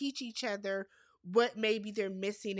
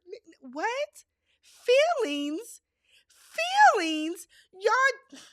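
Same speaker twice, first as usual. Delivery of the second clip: disbelief; 10 semitones higher, 8 dB louder, slower than usual. Real, not acted. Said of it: "host reacts in disbelief".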